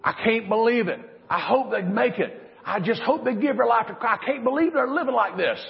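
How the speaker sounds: worried parents' lament; worried parents crying out over wayward children; mock parental scream. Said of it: "As our parents have screamed".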